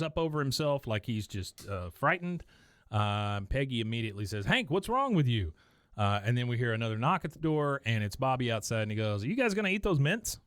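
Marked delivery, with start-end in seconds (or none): 4.5-5.5 higher-pitched mock exasperation; mildly alarmed question. Peggy after Hank's frightened scream, mock alarm. 9.2-10.4 high, quick imitation of a boy asking; lightly voiced boy. Bobby asking parents through the door, lightly imitated eagerness.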